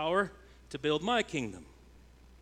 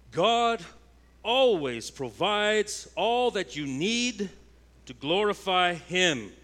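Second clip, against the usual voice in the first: slow, raised proclamation, every word weighed; preaching at full force; 5 semitones higher, 5 dB louder, slower than usual. Acted. Preacher assures the congregation God provides, slow and raised. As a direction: lift it up, slowly, like a promise from the pulpit.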